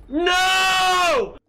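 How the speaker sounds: high, loud cry of no; desperate shout. Real, not acted. Gamer shouting as the screen goes blind, desperate. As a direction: yell it out in panic.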